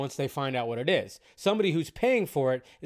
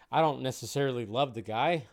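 ordinary delivery

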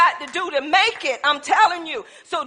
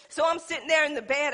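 ordinary talk, nothing marked

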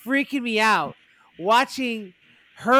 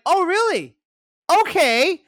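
mock delighted surprise; 9 semitones higher, 6 dB louder than usual. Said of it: "And they're like".

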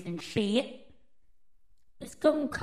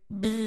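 bee buzz. Acted. We're just some worker bees.

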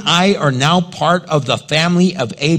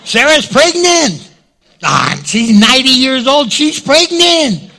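excited gossip; 8 semitones higher, 5 dB louder than usual. Acted. Gossip about old Sarah pregnant.

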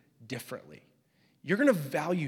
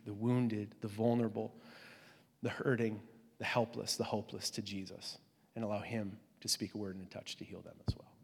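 whispered; 6 semitones lower, 9 dB quieter than usual. Real, not acted. Preacher's voice sinks.

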